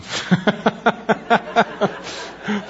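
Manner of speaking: high, sustained burst of laughter; real laugh. Real, not acted. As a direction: burst out laughing at your own story. Laughs at his own advice.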